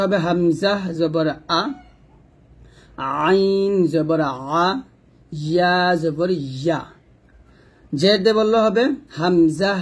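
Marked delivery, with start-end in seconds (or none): 3.2-4.7 আইন জবর আ very slow, pressed from the throat; slow pressed throat sound. Shown as a demonstration. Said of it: "গলার মাঝখান থেকে একটু চাপ দিয়ে". 5.3-6.8 ইয়া জবর ইয়া slow, tongue middle on the palate. Shown as a demonstration. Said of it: "জিহ্বার মধ্যখান, তার বরাবর উপরের তালুর সঙ্গে লাগিয়ে".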